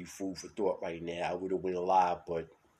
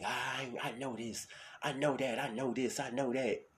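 mock know-it-all; 6 semitones higher, 4 dB quieter than usual. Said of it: "we claim we know everything".

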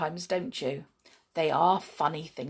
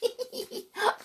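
put-on girlish giggle; 3 dB quieter than usual. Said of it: "she giggled again".

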